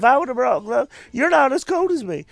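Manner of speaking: high, loud whiny protest; mock whiny complaint. Stuck on the ski lift, complaining to his buddy in a high whine. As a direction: whine it like a freezing friend.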